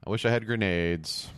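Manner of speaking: ordinary delivery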